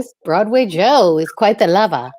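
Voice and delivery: normal talking voice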